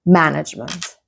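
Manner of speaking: management stressed on MAN; careful model management, beat on the first syllable. Shown as a demonstration. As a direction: hit MAN-agement right at the start. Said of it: "the first syllable".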